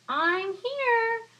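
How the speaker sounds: high, loud sly fox; a fox announcing himself. Acted. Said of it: "said the fox".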